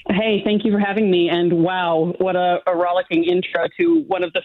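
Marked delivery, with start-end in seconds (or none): none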